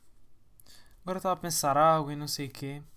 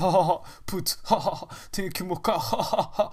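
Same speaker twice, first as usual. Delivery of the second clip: slow mock youtuber; 4 dB louder, slower than usual. Impersonates youtuber showing car.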